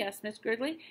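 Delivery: plain reading voice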